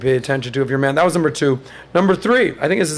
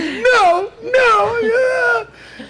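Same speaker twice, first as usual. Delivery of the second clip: much higher, wailing mock sobs; mock crying ex; much slower than usual. Man imitating his sobbing ex locked in the bathroom, high mock wail. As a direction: whimper it out like a hysterical girlfriend. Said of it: "And she's like".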